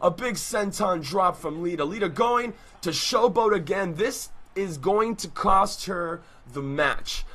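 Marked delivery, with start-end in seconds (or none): 0.0-2.5 rising hype, louder toward the kickouts; wrestling commentator. Acted. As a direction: call the match like a ringside announcer. Live wrestling play-by-play.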